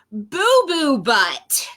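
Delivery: slow, grand announcement of the name; drawn-out silly name. Acted. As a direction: stretch out the name proudly. Book announces hippo's silly name.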